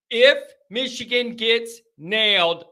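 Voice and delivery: usual speaking voice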